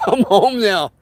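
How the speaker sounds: laughing. Real, not acted. Streamer answering a viewer about the day, laughing. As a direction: say it through a laugh.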